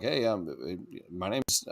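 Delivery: low, soft mumbled imitation; quiet, halting introduction. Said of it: "He usually talked kinda like".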